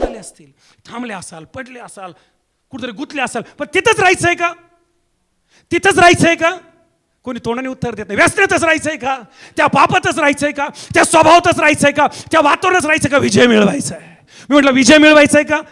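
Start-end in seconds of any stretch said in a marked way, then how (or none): 3.6-6.6 high, insistent rhetorical question; challenging preacher. Real, not acted. Challenges the congregation. 8.2-15.6 high, insistent rhetorical question; challenging preacher. Real, not acted. Challenges the congregation.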